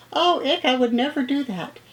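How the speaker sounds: high, squeamish protest; squeamish kid. Acted. Teacher voicing pupils who hate mud, high squeamish protest. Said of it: "Some of you will say".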